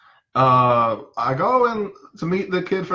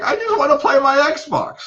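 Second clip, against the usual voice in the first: high-pitched mock wail; imitated tantrum whine; 10 semitones higher than usual. Boy begging therapist for his Xbox back, high imitated wail. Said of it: "he starts yelling".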